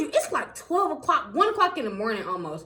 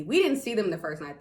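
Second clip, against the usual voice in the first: ordinary talk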